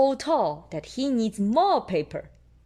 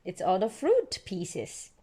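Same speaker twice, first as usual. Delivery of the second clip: plain reading voice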